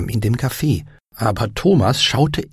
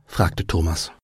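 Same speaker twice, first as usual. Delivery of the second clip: ordinary talk, nothing marked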